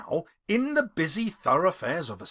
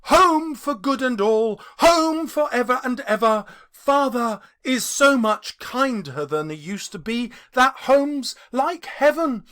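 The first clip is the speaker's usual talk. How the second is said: put-on high, loud and bubbling little girl; gleeful child; 7 semitones higher, 4 dB louder than usual. Little Fan gleefully telling her brother he's going home. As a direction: burst in full of joy for her brother. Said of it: "brimful of glee".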